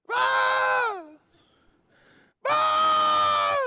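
high-pitched mock newborn wail; imitated baby cry. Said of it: "¿Usted sabe cómo lloran los niños?".

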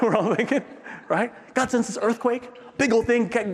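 normal talking voice

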